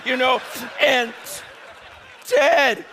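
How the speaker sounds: normal talking voice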